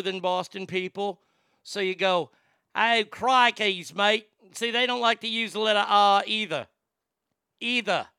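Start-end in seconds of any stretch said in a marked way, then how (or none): 2.8-4.3 high, loud mock Australian; slow, louder cartoon Australian accent. Shown as a demonstration. Demonstrating that Australia is higher than Boston, Australian accent. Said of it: "when you go to Australia". 4.7-8.1 high-pitched mock Australian; Australian accent. Shown as a demonstration. Showing Australians avoid the R, Australian accent. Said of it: "but it's higher".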